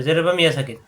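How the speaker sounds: ordinary talk, nothing marked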